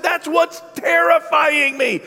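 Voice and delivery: high, slow, frightened shout; imitating scared shout. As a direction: shout it out in terror, slowly. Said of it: "She said".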